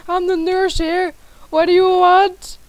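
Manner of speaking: lightly voiced playful nurse; hint of a sweet nurse greeting. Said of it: "Why am I talking like a nurse?".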